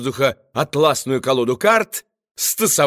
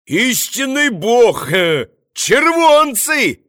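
high, loud, drawn-out yell; 7 semitones higher, 3 dB louder, slower than usual. A narrator's reading. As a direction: bellow it from the gallery. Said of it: "заорали на галёрке".

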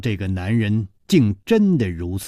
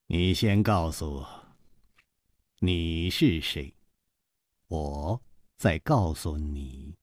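low, slow, soft teasing; unhurried playful coaxing, voice dropped; teasing, amused; 6 semitones lower, 7 dB quieter than usual. A narrator's reading. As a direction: take your time, quietly toying with them. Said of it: "笑嘻嘻地瞧着她说".

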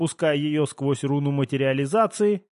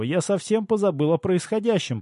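ordinary delivery, nothing marked

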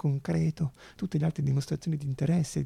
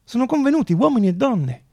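louder, measured address to the hall; formal speech; 5 dB louder than usual. Acted. Zamenhof addressing the congress, louder formal speech. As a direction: open the congress with ceremony.

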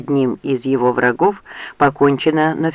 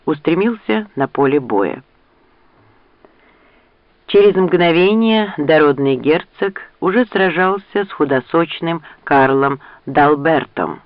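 ordinary delivery

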